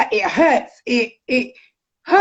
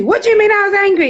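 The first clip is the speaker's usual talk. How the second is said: fast, raised mock-indignant snap; indignant retort; 7 semitones higher, 4 dB louder, faster than usual. Retelling her retort to her ex, raised and fast. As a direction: fire it back, offended and disbelieving.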